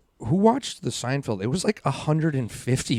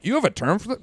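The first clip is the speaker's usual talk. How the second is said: much higher disbelieving question; incredulous; 9 semitones higher than usual. Retold or imitated. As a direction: react in mock disbelief. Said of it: "I'm like".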